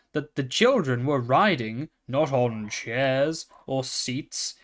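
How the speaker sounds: usual speaking voice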